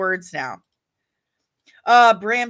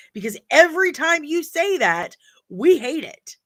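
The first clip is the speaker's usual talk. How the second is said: higher-pitched, insistent pleading with filmmakers; exasperated rant; 8 semitones higher, 4 dB louder than usual. Real, not acted. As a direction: rant it high and emphatic, fed up.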